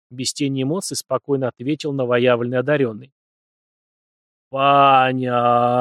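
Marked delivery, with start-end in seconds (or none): 4.5-5.8 very slow, drawn-out "Понятно"; thoughtful drawl. A narrator's reading. Priest mulling over the boy's gift: very slow, thoughtful. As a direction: stretch "Понятно" out while thinking. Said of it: "задумчиво протянул отец Василий".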